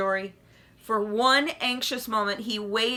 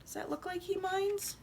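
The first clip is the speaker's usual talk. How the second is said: quiet aside; 10 semitones higher, 10 dB quieter than usual. Real, not acted. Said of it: "aside to child".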